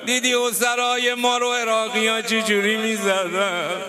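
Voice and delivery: half-chant the grief at full force; wailing lament preacher. Acted. Preacher laments Zeynab and bound children, wailing.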